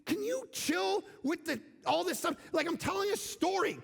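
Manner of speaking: husband fed up with interruptions, calling out; exasperated. Retold or imitated. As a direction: snap back at the interrupting wife. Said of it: "I'm like".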